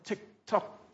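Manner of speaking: soft, ticking tick tock; hushed imitation of a clock; mock clock ticking. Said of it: "We go, "Oh, time".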